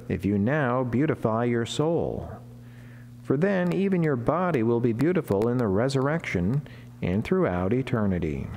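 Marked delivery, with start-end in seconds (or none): none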